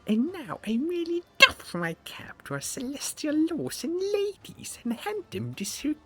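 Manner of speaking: very high whispered fool's voice; squeaky whispering jester. Acted. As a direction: hiss it like a cheeky little fool. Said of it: "I have to give him a Lemmy voice".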